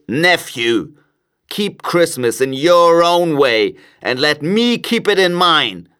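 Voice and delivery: high-pitched, testy miser's rebuke; irritated miser laying down terms; stern Scrooge. A narrator's reading. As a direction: dismiss him crossly, pitch climbing. Scrooge rebukes his nephew.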